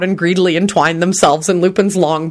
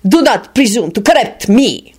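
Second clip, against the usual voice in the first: higher, icy rebuke; glacial; 5 semitones higher than usual. A narrator's reading. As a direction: put him in his place icily. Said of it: "replied in a glacial tone".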